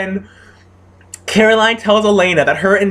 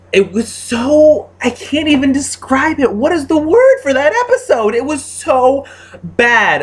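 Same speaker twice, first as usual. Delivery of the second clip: groping for words, pitched up in disbelief; strained high-pitched rant; exasperated disbelief; 8 semitones higher than usual. Real, not acted. Ranting in disbelief about episode.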